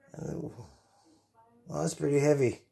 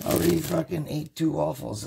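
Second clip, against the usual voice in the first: ordinary delivery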